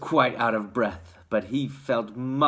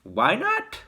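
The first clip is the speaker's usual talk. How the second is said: shopkeeper; 11 semitones higher than usual. A narrator's reading. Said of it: "the fat shopkeeper said".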